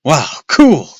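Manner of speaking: loud, thrilled shouts; excited. A narrator's reading. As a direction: shout with delight. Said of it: "Luke started to laugh".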